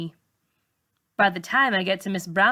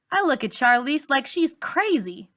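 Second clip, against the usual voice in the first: plain reading voice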